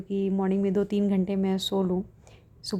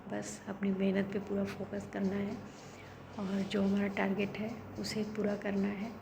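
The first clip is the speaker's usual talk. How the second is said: quietly confiding to viewers at night; hushed late-night voice; 10 dB quieter than usual. Real, not acted. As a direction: keep it down for the sleepers. Still talking while family sleeps.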